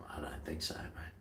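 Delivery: breathy whispered aside; whispering. Real, not acted. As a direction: drop to a whisper. Muttered aside to viewer.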